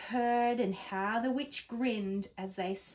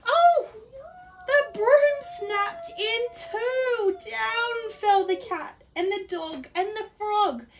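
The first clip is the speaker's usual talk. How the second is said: alarmed; 11 semitones higher, 8 dB louder than usual. A narrator's reading. Reading the broom snapping to a child, in alarm. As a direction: tell the disaster with rising alarm.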